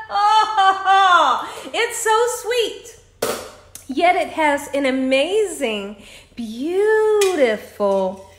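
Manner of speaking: high, loud, drawn-out raptures; delighted rave. Real, not acted. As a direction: gush over the first sip, stretching the words. Delighted reaction on first sip.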